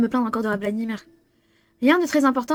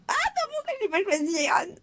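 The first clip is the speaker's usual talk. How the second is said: whiny regret; 9 semitones higher, 5 dB quieter than usual. Real, not acted. Regrets not choosing Aaron.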